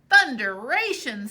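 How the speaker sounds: loud exclamation; exclaiming. Acted. Tom reacting to the buried gold, loud exclamation. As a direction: burst it out.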